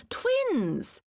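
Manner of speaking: sudden high exclamation of surprise; surprised. Acted. Assassin startled to find a boy, surprised high exclamation. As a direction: caught off guard, voice jumping up. Assassin realizes there are two.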